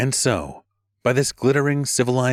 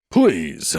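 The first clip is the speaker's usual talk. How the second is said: louder dismissive scoff; 4 dB louder than usual. Acted. Animal leader scoffing about Gorday. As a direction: brush the idea away. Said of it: "Animal scoffed".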